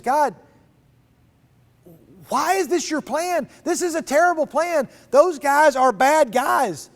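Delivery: frustrated prophet protesting to God, high and pleading; exasperated complaint. Acted. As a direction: throw up your hands at God.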